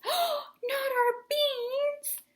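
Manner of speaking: high, slow, stretched outraged wail; outraged cry. A narrator's reading. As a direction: wail it in horror. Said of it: "cried Scipito, outraged".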